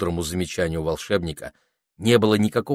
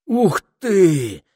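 high, loud wowed exclamation; thrilled high "wow"; greatly impressed; 12 semitones higher, 7 dB louder than usual. A narrator's reading. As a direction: gasp it out in awe. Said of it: "произвело на Этельреда сильное впечатление".

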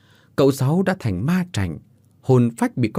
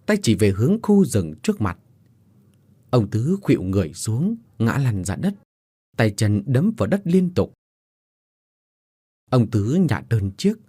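plain reading voice

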